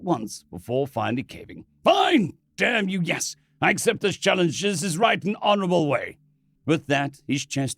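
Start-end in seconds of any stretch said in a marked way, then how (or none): 1.8-6.2 angry. Acted. Ronson caves angrily.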